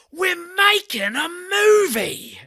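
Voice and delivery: high, drawn-out mock bellow; Jason Statham yelling. Actor yells at a bystander on set, imitated top-volume yell. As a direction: yell it slowly, stretching the words right out. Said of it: "Jason Statham yells at top volume".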